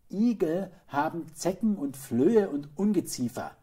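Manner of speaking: high, loud, decided mother; voiced mother, emphatic and certain; firm. A narrator's reading. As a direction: lay it down as a firm rule. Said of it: "sagte die Mutter bestimmt".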